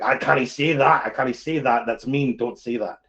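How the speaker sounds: emphatic Scottish self-scolding; Scottish accent. Acted. Streamer stopping their own mean side, emphatic Scottish accent. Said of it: "I try and do a Scottish accent".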